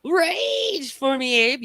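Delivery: slow, high teasing drawl; taunting drawl. Real, not acted. Slow high taunt at the troll who left. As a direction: dangle the bait slowly, high.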